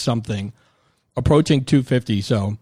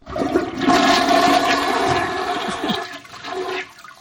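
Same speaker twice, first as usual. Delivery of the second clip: breathy mock toilet flush, rising high then falling; toilet flush noise. Host fakes a flush for the listeners, whooshing. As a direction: blow out a whooshing flush sound. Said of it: "toilet flushing sound effect".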